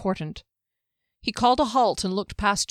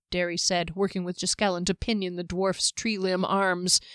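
ordinary delivery

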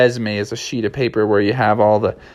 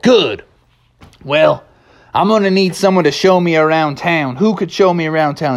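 higher-pitched southwestern cowboy; southwestern drawl; 5 semitones higher than usual. Acted. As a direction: ask it like a southwestern sheriff. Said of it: "talking with a nice southwestern drawl".

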